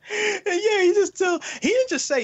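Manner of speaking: very high, loud excited outburst; excited squeal. Real, not acted. Fan gushing about the yelling boss, high excited squeal. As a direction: squeal with glee about the scene.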